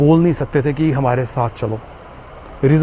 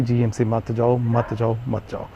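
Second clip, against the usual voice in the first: a hushed inner voice, fading softer with each repeat; inner voice warning; 4 semitones lower, 7 dB quieter than usual. Retold or imitated. Patient recalls his inner voice warning him, fading hush. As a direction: let the warning die away like a voice inside you. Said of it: "अंदर से आवाज़ आ रही थी".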